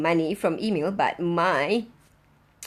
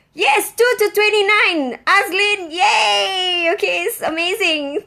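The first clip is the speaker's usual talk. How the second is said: real joyful cries, high and loud; squealing with real delight; thrilled cheering; 10 semitones higher, 7 dB louder than usual. Real, not acted. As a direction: cheer out the good news. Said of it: "I'm so excited".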